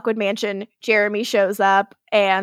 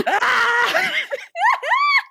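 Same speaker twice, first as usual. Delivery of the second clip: high, bursting laugh; shrieking burst of laughter; big laugh. Real, not acted. Laughing at flirty quote.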